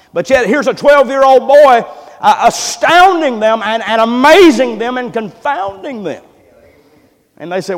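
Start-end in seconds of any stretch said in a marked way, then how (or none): 0.1-6.2 rising, louder preacher's build; preaching at full force. Acted. Preacher building to a loud climax for the church. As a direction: lift the congregation with it.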